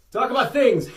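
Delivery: high, loud mock command; offhand loud order. Host too busy eating, loudly ordering co-host to talk. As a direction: toss out a topic loudly.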